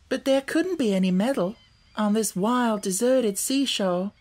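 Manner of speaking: put-on high-pitched girl, insisting; persistent. A narrator's reading. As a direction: keep arguing as a doubtful child. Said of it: "persisted the girl".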